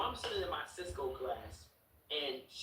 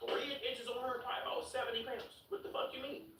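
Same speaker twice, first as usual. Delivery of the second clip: much higher, hammed-up disbelieving voice; mock hype; 10 semitones higher than usual. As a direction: pump her up in a squeaky rant. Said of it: "So I'm like".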